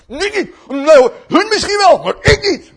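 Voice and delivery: high, loud denial; emphatic acted objection; loud boastful protest. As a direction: protest over everyone as cocky Peter. Peter protests loudly, acted.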